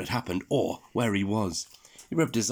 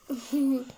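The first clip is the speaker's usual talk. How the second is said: giggle. Acted. Laughter again.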